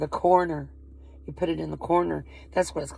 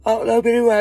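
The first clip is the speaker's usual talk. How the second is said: friend's loud reply; 6 semitones higher, 10 dB louder, much faster than usual. Retold or imitated. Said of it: "And she said".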